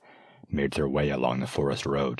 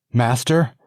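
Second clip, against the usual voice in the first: high, loud grieving call; mournful cry; 8 semitones higher, 8 dB louder than usual. Acted. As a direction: cry out to a fallen master. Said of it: "a mournful wail went up from the squire".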